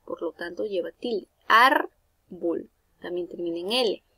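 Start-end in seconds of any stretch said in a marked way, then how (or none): 1.5-2.7 "Árbol" with ÁR punched out; stress on first syllable. Shown as a demonstration. Teacher giving another llana example, "Árbol" with stressed ÁR. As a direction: hit ÁR, then let "bol" fall away in "Árbol". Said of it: "La mayor fuerza de voz recae".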